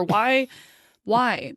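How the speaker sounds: usual speaking voice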